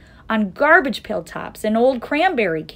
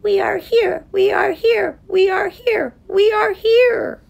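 high, thin, tiny-crowd cry; crying out in fear; 13 semitones higher, 6 dB louder than usual. A narrator's reading. Townspeople cry out to prove they exist, fearful. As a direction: chant it desperately, last one drawn out. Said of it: "his people cried loudly. They cried out in fear".

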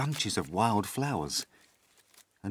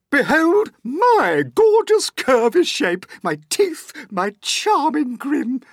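vain Aunt Spiker boasting; 11 dB louder than usual. Acted. Aunt Spiker boasting to her sister, high and loud. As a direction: purr over your own beauty, then gloat. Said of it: "Aunt Spiker said".

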